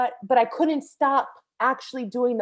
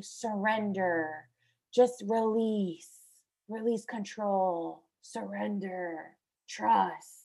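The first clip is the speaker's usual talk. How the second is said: very soft, slow, lowered voice; 3 semitones lower, 7 dB quieter, much slower than usual. Retold or imitated. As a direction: speak slowly and hushed, coaxing someone to let go.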